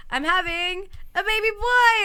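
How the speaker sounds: lightly voiced high sing-song wife; a hint of a giddy Maria; mock Maria voice. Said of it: "And she's like, "So".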